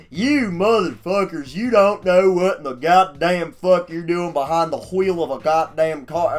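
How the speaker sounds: loud, high, hammed-up Southern tirade; thick Southern accent. Southern dude screaming at teen drivers, thick accent. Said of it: "the thickest southern accent ever".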